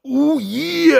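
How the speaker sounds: quick hyped wrestler call; Macho Man catchphrase. Retold or imitated. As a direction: toss off the wrestler's signature line. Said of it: "how many times does Hero Fig say, "Ooh, yeah".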